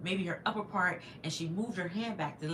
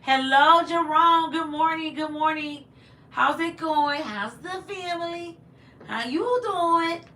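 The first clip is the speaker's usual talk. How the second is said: loud, high sing-out hello; exuberant greeting; 11 semitones higher, 10 dB louder, slower than usual. Real, not acted. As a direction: call out to a welcome guest, stretching the last words. Greets a viewer who just joined.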